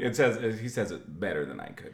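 ordinary talk, nothing marked

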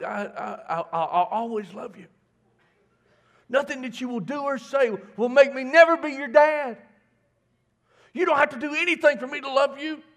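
a father swearing love to a heartbeat; emotional promise to baby. Retold or imitated. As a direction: pour your heart out to the unborn child. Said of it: "I was promising all this to this heartbeat".

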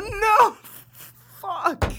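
high-pitched cry, falling away; retold dismay. Co-host reliving the lost round, high-pitched dismay. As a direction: replay the agony of a near miss. Replaying his dismay at losing.

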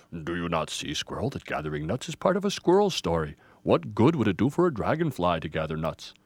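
low, weighty elder voice; stern wise Grandfather tree. Acted. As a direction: instruct with grave, patient authority. Grandfather rebukes Squirrel.

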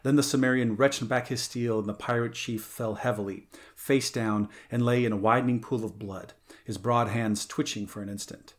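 usual speaking voice

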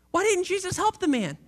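usual speaking voice

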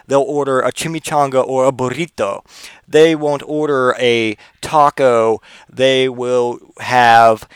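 slow, show-off Spanish pronunciation; hammed-up Spanish accent on the food words; affected Spanish accent. Cultured guy showing off at the Mexican restaurant, affected Spanish accent. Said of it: "they put the accent on it".

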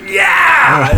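very high, loud mock-manic yell; crazed yell. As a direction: yell it like the crazy guy being imitated. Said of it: "he's being all fucking crazy".

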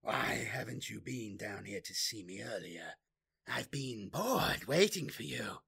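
taunting, bored demon; a sly, mocking demon voice. A narrator's reading. Demon character voice.

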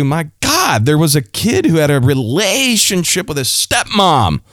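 high-pitched shocked gossip; scandalized. Real, not acted. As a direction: gasp it out, scandalized by the story. Scandalized aside about Corinthian church.

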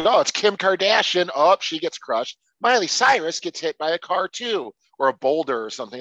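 sarcastic gee-whiz; mock excitement. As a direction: sell the dumb gag with fake cheer. Mocking the movie's humor.

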